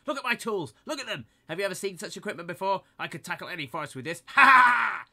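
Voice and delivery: loud, high, fast bragging shout; angry boast. A narrator's reading. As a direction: show off, shouting.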